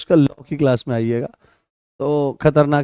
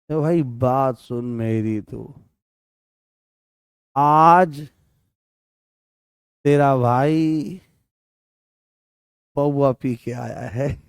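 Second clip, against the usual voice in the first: tipsy friend; slower than usual. Acted. Teacher voicing a friend who admits drinking, slow mock-drunk drawl. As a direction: stretch the words like a man who's had a drink.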